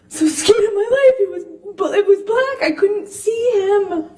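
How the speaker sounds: crying. Real, not acted. Reliving the assault, breaking down.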